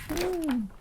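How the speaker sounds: usual speaking voice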